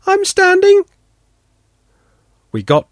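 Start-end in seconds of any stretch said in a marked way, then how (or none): 0.1-0.8 put-on high, small, plaintive child's call; a little girl answering from far off. Daughter answers her father's call, small plaintive voice. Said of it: "a plaintive little voice".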